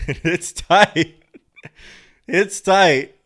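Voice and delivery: laughing. Real, not acted. Laughing retelling of the exam.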